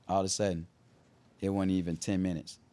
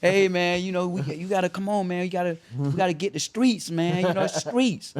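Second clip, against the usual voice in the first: high, louder coaxing rapper voice; 10 semitones higher, 4 dB louder than usual. Retold or imitated. Tip urging the speaker to say the word, high coaxing imitation. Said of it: "I like to imitate people".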